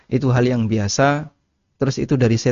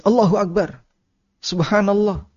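higher, lightly startled exclamation; mock startled praise; 8 semitones higher than usual. Startled person blurting praise to God, mock surprise. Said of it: "ketika dia kaget".

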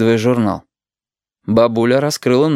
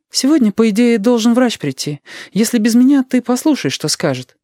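high-pitched mother's voice; high, businesslike mum; busy mother; 13 semitones higher than usual. A narrator's reading. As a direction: give parting instructions as a mum, pitched up.

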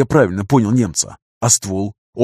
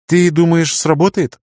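put-on higher-pitched young woman's voice; girl's question; 7 semitones higher than usual. A narrator's reading. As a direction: ask as a girl, pitched up. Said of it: "спросила Ната, нарушая молчание". (девичий голос с вопросом)

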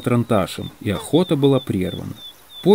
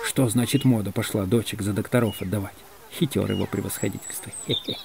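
whispered gossip; 2 semitones lower, 8 dB quieter than usual. A narrator's reading. Егор Егорыч gossiping about the general, hushed and sly. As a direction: gossip under the breath, then snicker. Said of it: "шепнул Егор Егорыч доктору на ухо".

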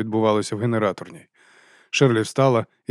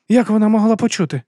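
high, louder amazed question; astonished; 11 semitones higher, 5 dB louder than usual. A narrator's reading. Women wondering how she could have heard, astonished. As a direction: ask in amazement, pitch raised. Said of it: "Шерлі теж була вражена".

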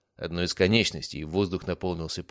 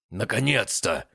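roar; 4 semitones higher, slower than usual. Acted. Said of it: "взревел он".

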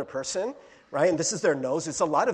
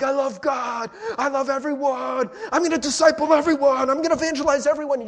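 higher, louder, rushing, overexcited; spiritual-high gushing; 7 semitones higher, 7 dB louder than usual. Retold or imitated. Believer back from retreat, gushing with mock excitement. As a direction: gush like you're on fire for God. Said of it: "You're just like".

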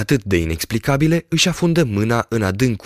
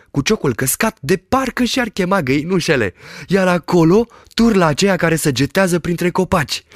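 high, lively shepherd voice, delighted; excited shepherd; 8 semitones higher than usual. A narrator's reading. Shepherd describes the village he sees, high and thrilled. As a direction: rattle off the sights in wonder.

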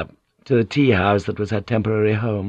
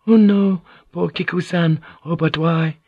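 high, dismayed exclamation; shocked gossip; 8 semitones higher than usual. A narrator's reading. Maid hears Kiku's misfortune, high shocked dismay. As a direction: react aghast in a high-pitched voice.

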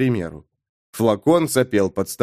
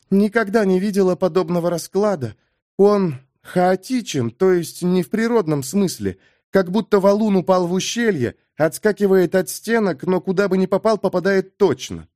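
higher-pitched woman's character voice; woman card reader, puzzled; 7 semitones higher than usual. A narrator's reading. Amber explains the chaotic spread to the adjutant, puzzled woman's voice. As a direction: voice her high, explaining a baffling card spread.

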